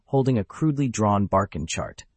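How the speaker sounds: usual speaking voice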